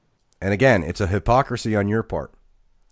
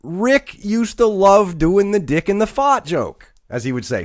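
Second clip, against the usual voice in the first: usual speaking voice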